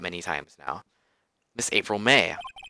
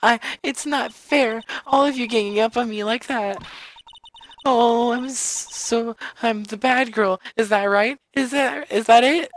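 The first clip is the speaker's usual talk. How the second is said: mock tearful; 14 semitones higher, 5 dB louder than usual. Witness playing victim to the court, high tearful whine. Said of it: "Oh, now she's crying".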